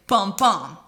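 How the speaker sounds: "bum, bum" with the second bum stressed; unstressed-stressed heartbeat. Shown as a demonstration. Teacher sounding out an iamb like a heartbeat, stress on second bum. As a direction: beat out "bum, bum", weak then strong. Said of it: "it kinda goes like".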